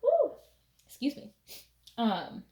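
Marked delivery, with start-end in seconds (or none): 0.0-0.3 high, sudden little whoop; whoop after a sneeze. Real, not acted. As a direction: shake off a sneeze with a yelp. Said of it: "I need to sneeze".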